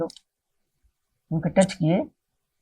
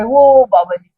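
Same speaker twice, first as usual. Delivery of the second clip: loud, higher, drawn-out "there he is" call; pointing out from afar; 4 semitones higher, 10 dB louder, slower than usual. Retold or imitated. Companion pointing out Baba Ji from afar, calling out. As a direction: imitate someone pointing out a figure far off. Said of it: "अब इसने दूर से उंगली उठाई".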